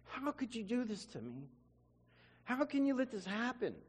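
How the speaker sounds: high, pleading cry to God; anguished crying prayer. Retold or imitated. Alone in his room crying to God, a high anguished plea. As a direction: plead with God through tears. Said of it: "I cry my heart out".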